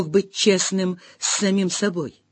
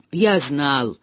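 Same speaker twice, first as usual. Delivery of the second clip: lower, sighing, hopeless; heavy sigh; 11 semitones lower, 4 dB quieter than usual. A narrator's reading. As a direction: sigh the words out heavily. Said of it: "тяжко вздохнул Бен".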